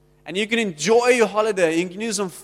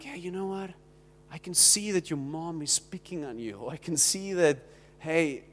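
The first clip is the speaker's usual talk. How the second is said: caring comfort; 4 semitones lower, 6 dB quieter than usual. Pretend. Comforting a suffering friend.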